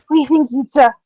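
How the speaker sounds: normal talking voice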